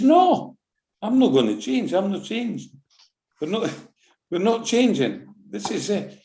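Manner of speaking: high, insistent mock argument; defiant refusal. Manager refusing to change kit.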